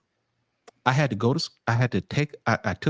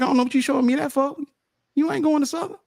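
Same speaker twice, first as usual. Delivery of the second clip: high-pitched mock mother brushing it off; dismissive mom; 11 semitones higher, faster than usual. Acts out mom's dismissive reply.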